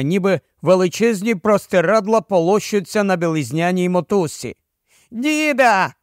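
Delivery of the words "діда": put-on high-pitched little boy's cry; boy shouting for grandpa; child's shout. A narrator's reading. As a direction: call out as a small boy. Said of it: "закричав малюк".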